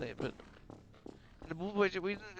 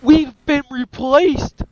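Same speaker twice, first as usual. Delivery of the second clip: high, drawn-out yell; shocked shout; 11 semitones higher, 17 dB louder, much slower than usual. Acted. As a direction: cry it out in dismay, very loud. Acted outraged discovery in story.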